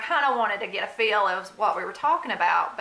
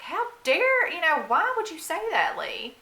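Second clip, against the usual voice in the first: indignant retort; 10 semitones higher, 4 dB louder than usual. Retold or imitated. Mother's indignant retort to husband.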